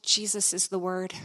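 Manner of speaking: low, soft, hushed statement; hushed realisation. Retold or imitated. Woman straightens and answers the speaker, hushed realisation. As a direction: say it low and softly, as it dawns.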